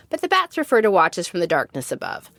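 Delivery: ordinary delivery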